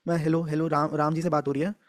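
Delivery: a nervous salesman mumbling into the phone; timid caller. Shown as a demonstration. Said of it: "अभी मैं एक call करूंगा".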